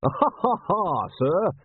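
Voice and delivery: high, spelled-out ha-ha; dutiful fake laugh. A narrator's reading. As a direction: laugh stiffly at a superior's joke. Butler answering superior's joke.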